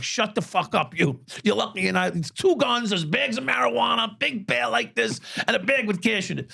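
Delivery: high, heated berating; angry retold yelling. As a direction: snap at him from above, pitched high. Yelling at dealer on floor.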